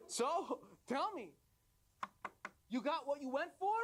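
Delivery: high-pitched, eager royal questioning; expectant king. Acted. King asking the traveler for news, expectant questioning.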